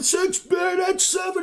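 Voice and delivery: high, slow shouted bid; pit trader yelling. Retold or imitated. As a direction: yell the bid across a noisy pit. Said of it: "all the screaming and the yelling".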